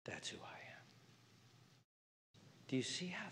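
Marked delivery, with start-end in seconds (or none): 0.0-0.8 intimate whisper. Real, not acted. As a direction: breathe it out like a secret blessing. Whispered affirmation of identity.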